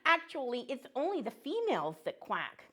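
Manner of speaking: high, cheerful cartoon duck voice; a chirpy little duck saying hello to kids; friendly duck puppet.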